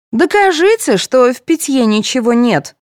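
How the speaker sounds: high, insistent challenge; demanding. A narrator's reading. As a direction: demand proof, starting loud and high. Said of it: "потребовала Таня".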